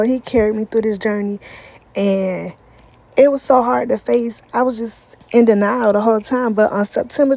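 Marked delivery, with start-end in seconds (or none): none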